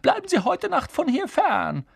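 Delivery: very high, raspy command; croaked order. A narrator's reading. Harry sending Peeves away as the baron, croaking. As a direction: croak a lordly warning. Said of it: "krächzte Harry".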